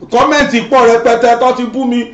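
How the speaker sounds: normal talking voice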